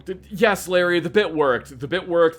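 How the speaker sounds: loud and delighted; excited. Real, not acted. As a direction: cheer that it worked. Excited the bit worked.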